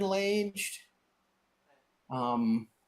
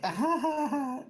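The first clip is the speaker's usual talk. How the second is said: high, abashed nervous chuckle; high sheepish fake laugh; awkward laugh; 12 semitones higher than usual. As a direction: laugh it off, embarrassed. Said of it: "he kinda gives you this".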